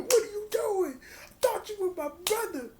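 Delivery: high, wounded cry of disbelief; heartbroken betrayal. Retold or imitated. Betrayed friend crying out at his brother. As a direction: voice jumps up in hurt shock.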